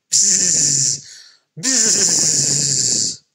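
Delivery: buzzing like a bee. A narrator's reading. Storyteller buzzing for the little bee. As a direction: buzz it out.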